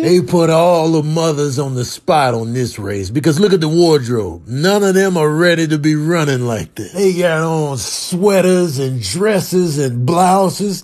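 normal talking voice

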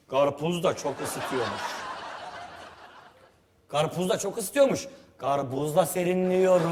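slow, drawn-out demo line; slow, relishing example read for the actor; line read slowly. Shown as a demonstration. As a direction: savour each word, dragging it out. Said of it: "Sonra şey diyorsunuz".

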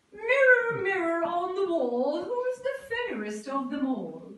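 loud, high imperious demand; queen's shout. A narrator's reading. Spoiled queen shouting at her magic mirror, loud and high. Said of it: "the spoiled and stupid queen would shout".

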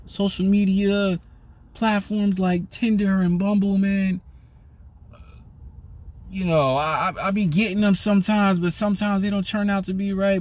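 lightly voiced guy grumbling about Tinder; mock guy complaining. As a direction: play a whiny guy, only lightly. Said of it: "a lot of guys be out here".

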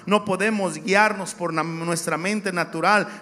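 loud, rising preacher cadence; fiery sermon delivery; preaching. Acted. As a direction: preach it to the congregation with force. Preaching at full force.

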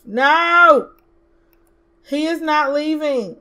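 yelled no at the game; loud no. Real, not acted. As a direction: stop them with one shout. Shouting at a Sim leaving.

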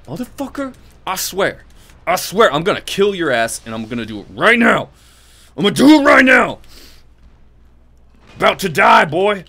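loud, high, building to a full shout; furious threat. Real, not acted. Gamer furious at a boss, shouting threats. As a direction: rage at the monster, threatening.

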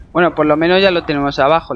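normal talking voice

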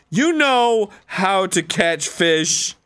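slow, spelled-out coaxing; slow insistence. Pretend. Player insisting to Codex he can fish, slow and deliberate. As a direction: stretch out every word as if coaxing.

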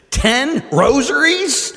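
loud, high, drawn-out disbelief; mock outrage. Teasing a fellow priest over his harsh penance, loudly incredulous. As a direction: stretch it out, aghast at the very idea.

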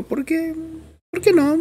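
squeaky high-pitched questioning; mock questioning. Teacher jokingly questioning his own recording choice, very high mock voice. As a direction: ask it way up high, playfully.

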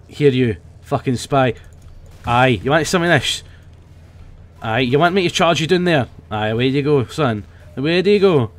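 put-on loud, rowdy Scottish accent. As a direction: play a brash Scottish brawler, Scottish accent. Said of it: "we're gonna be playing some Scottish Demo Night".

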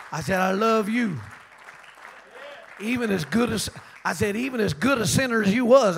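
loud, beaming pulpit climax; a preacher celebrating a saved man before the church; joyful preaching. Acted. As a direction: shout the love out with a laugh.

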